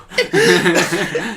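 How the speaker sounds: high, loud burst of laughter; loud cackling laugh. Real, not acted. Cracking up at friends' crude joke, loud laugh. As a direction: crack up loudly.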